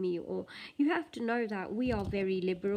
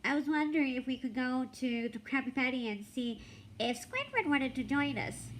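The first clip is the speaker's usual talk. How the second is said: higher, eager cartoon sponge; English SpongeBob voice; 4 semitones higher than usual. Vlogger switching to the English SpongeBob, higher voice. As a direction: chirp it like an excited cartoon sponge. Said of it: "I should do the English one now".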